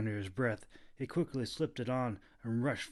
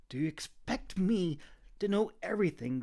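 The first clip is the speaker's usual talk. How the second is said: annoyed growl; 7 semitones higher, 3 dB louder than usual. A narrator's reading. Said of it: "Kita growled in annoyance".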